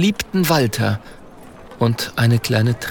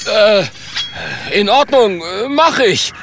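hoch, kleinlaut und stockend; zögernd; 9 semitones higher than usual. Acted. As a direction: stimme zögernd mit hoher Stimme zu. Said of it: "Zögernd". (ängstlich zögernd, mit hoher Stimme)

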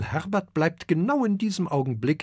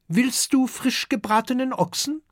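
much higher, friendly offer; hospitable dragon mother; 10 semitones higher than usual. A narrator's reading. Dragon mother offers the little guest roast ox, high. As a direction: offer the guest food kindly. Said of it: "fragt den kleinen Gast".

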